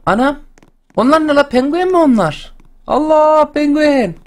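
very high, astonished cry; high-pitched gasp of wonder; amazed squeal. Real, not acted. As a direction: squeal in delighted disbelief at the penguins. Spots penguins in the game.

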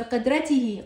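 قدرته said qudratihi, ending tihi; voweled h ending. Shown as a demonstration. Teacher showing the constant h, قدرته ending in tihi. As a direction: end قدرته on ti-hi. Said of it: "فهنا لفظتها هاء".

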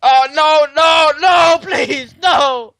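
high, loud shouts of no; panicked shouting. Real, not acted. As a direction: beg loudly not to die. About to be killed.

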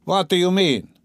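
haughty stern challenge. Acted. Gentleman demanding an answer, stern and haughty. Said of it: "the gentleman asked sternly".

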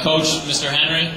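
normal talking voice

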